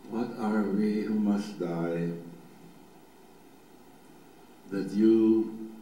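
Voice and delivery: low, meditative reading with long pauses; slow psalm reading. Shown as a demonstration. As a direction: read it slowly so each image can form. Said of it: "I'm gonna do it nice and slow".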